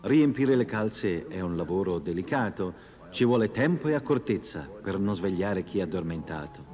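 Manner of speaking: ordinary delivery, nothing marked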